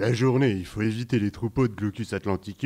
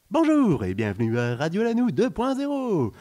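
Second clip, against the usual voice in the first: radio announcer; 11 semitones higher, 5 dB louder than usual. Acted. Radio jingle greeting.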